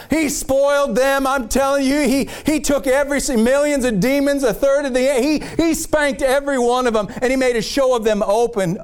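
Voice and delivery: preacher heating up; rising preaching. Real, not acted. Preaching builds again.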